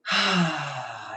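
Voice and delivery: voiced falling "ahh" sigh; relaxed audible sigh of release; big sigh. Shown as a demonstration. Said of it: "take a nice big sigh".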